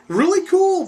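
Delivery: a high, loud yelp of delight; excited. Real, not acted. Thrilled by the pulsating brain, high and loud. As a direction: squeal it out with glee.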